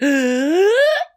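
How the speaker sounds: a high, hammed-up startled gasp; theatrical gasp of shock; mock gasp. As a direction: gasp as if caught off guard. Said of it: "He's like".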